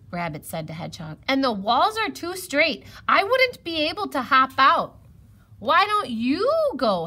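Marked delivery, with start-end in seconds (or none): 1.3-5.0 high, loud rabbit voice; raised, high-pitched objection; worried rabbit. A narrator's reading. Rabbit explains he cannot help. 5.6-7.0 high, loud question; pitched-up challenge; challenging squirrel. A narrator's reading.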